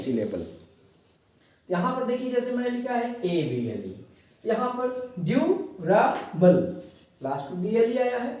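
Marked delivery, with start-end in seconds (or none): 5.2-6.8 ड्यूरेबल slowly split into du, ra, bal; slow, evenly spaced syllables in ड्यूरेबल. Shown as a demonstration. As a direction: break ड्यूरेबल into separate syllables. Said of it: "अलग-अलग syllable को मैंने line खींच दिया".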